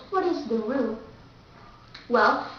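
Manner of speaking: ordinary talk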